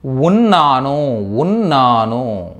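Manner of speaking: ఉన్నాను stretched out very slowly, syllable by syllable; slow teaching drill. Shown as a demonstration. Teacher slowly spelling out the meaning for students. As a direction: draw out ఉన్నాను slowly for learners. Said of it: "who అంటే అర్థం ఏంటంటే".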